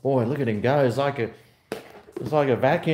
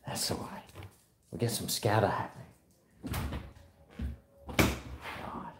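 whispering; 2 semitones lower, 10 dB quieter than usual. Real, not acted. Whispering to the dog.

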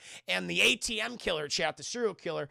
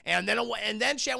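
ordinary delivery

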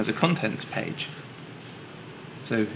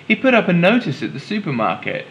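ordinary delivery

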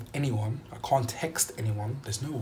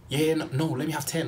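pushy demand; 5 semitones higher, 4 dB louder than usual. Retold or imitated. Crackhead ups demand.

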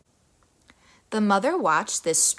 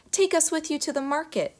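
put-on higher pleading little girls; begging twins; 9 semitones higher than usual. A narrator's reading. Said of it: "the twins begged".

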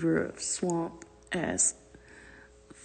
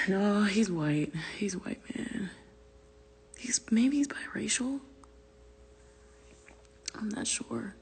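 whispering. Real, not acted. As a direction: whisper your doubts to chat. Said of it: "You sound tired".

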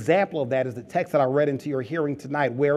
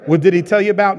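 pressing wife; 3 semitones higher, 7 dB louder, faster than usual. Retold or imitated. Wife grilling him after hangout.

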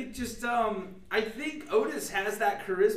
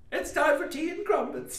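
mock British accent; 6 semitones higher than usual. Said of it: "Please do your page accent".

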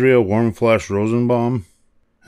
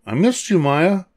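put-on high girl's voice; affectionate big sister; 9 semitones higher than usual. Lucy hugging her sister, high affectionate voice. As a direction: say it fondly, pitched high.

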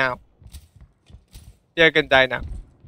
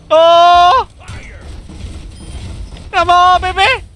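hyped battle cries; 8 semitones higher, slower than usual. Real, not acted. Player urging on the team mid-fight, hyped battle cries. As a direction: yell taunts and urges at full excitement.

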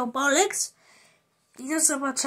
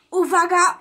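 loud announcement; 4 semitones higher, 9 dB louder than usual. A narrator's reading. Chief judge addressing the stadium crowd, loud announcement. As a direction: call for attention, higher and much louder. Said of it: "ogłosił".

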